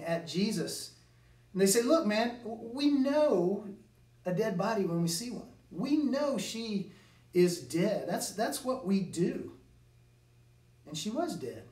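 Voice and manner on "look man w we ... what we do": higher, scornful know-it-all tone; mocking. Mourners mocking Jesus, higher and scornful. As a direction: sneer like hired mourners who know better. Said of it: "The mourners quickly become mockers".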